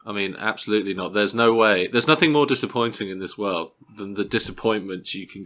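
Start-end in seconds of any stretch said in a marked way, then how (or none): none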